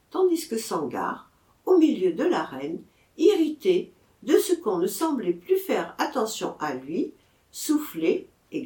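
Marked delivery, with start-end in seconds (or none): none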